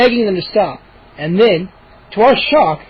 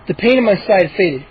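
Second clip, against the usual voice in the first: plain reading voice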